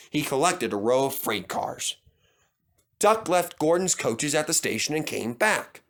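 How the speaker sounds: ordinary delivery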